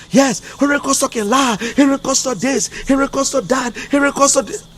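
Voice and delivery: high-pitched mimicked complaining; mocking mimicry. Retelling what people say about Henry Costa, high mocking mimicry. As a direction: rattle it off high, like repeated accusations.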